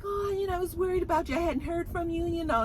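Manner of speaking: high, soft, worried phone-call voice; Diana Diane. Retold or imitated. Girlfriend calls him because she was worried, imitated worried voice. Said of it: "Diana Diane calls and just like".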